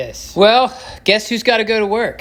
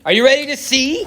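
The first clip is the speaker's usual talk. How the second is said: higher, laughing tease of are you ready to see; giddy higher repeat of ready; excited; 6 semitones higher than usual. Acted. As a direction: pump up the viewers with a laugh. Teasing build-up, repeated higher.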